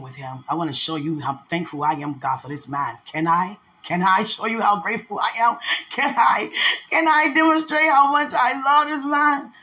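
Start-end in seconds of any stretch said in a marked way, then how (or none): 3.0-9.5 higher, louder, swelling plea to God; overflowing with grateful love; impassioned plea. Real, not acted. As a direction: plead it out, climbing in pitch and volume.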